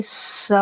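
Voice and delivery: स hissed unvoiced with a whistling edge; whistled sibilant स; whistling sin. Shown as a demonstration. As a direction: let the स whistle softly. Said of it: "सीटी की आवाज़ आनी चाहिए".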